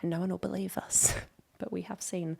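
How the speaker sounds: awed secret whisper with a laugh. Real, not acted. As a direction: tell it like a marvel only we saw. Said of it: "awed hushed confession".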